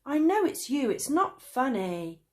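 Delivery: high, loud accusation; angry. A narrator's reading. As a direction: accuse him in anger. Traveller quarrel.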